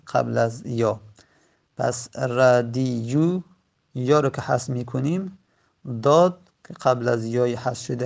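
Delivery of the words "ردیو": ردیو sounded out very slowly, radiyu; slow spelled-out form. Shown as a demonstration. Teacher recalling the original form, slowly sounding out ردیو. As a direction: stretch ردیو vowel by vowel. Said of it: "اصلش بوده ردیو بر وزن فعیلو".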